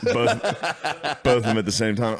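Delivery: ordinary talk, nothing marked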